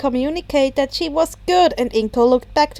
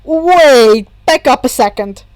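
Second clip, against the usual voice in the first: loud, startled wait; confused; 9 dB louder than usual. A narrator's reading. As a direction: pull up short in confusion.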